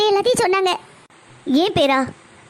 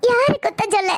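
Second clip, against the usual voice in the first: high, tearful, helpless lament; tearful despair; 4 semitones higher than usual. Acted. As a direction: break down while trying to explain. Distraught mother pleading with doctor. (அழுகையோடு தவிப்பு)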